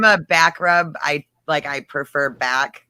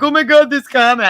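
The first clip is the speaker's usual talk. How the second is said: high, loud mock amusement; imitated laughing glee; 11 semitones higher, 8 dB louder than usual. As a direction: mimic a friend cracking up over a phone. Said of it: "go into his phone laughing".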